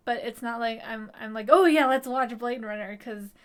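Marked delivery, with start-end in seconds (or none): 1.5-3.0 loud, overeager sarcastic quote; hammed-up eager suggestion; mock excitement.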